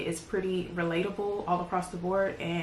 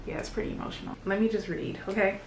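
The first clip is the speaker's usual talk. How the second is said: normal talking voice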